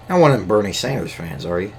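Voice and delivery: ordinary talk, nothing marked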